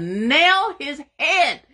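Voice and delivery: high, loud, slow proclamation; preaching climax. Real, not acted. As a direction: swell up on it like a preacher. Preaching victory over enemy.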